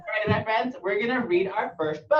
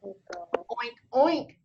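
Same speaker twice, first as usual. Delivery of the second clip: spoken storybook oink, jumping up on the second; playful pig noise; pig's oink; 4 semitones higher than usual. A narrator's reading. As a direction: oink like a pig for little kids. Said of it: "said the pig".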